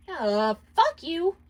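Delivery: high, slow, drawn-out snarl of contempt; rude retort. Acted. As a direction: spit it back at her. Millie flips off Luna.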